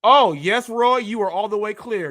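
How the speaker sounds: usual speaking voice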